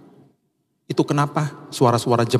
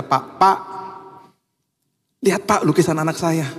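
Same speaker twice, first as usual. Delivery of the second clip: polite higher-pitched call to an acquaintance; mildly formal, raised-pitch greeting; polite distant address; 5 semitones higher than usual. Retold or imitated. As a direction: call him over with respectful reserve. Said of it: "karena nggak begitu dekat".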